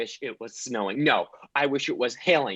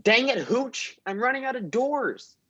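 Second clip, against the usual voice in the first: exasperated; 12 semitones higher than usual. Acted. Exasperated at dog.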